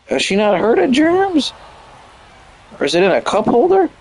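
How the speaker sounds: can't believe anyone would do this; incredulous disbelief. Real, not acted. Streamer reacting to fan's pretzel on floor, high incredulous.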